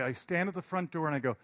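normal talking voice

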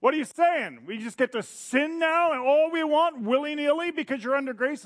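high, loud outraged yell; choir yelling. Retold or imitated. The choir yells at Paul in high, loud outrage. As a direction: shout the objection in disbelief. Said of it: "The choir is yelling".

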